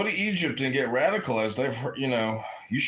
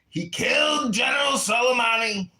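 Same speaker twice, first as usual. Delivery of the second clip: shouting; 7 semitones higher than usual. Acted. Trump voice rant.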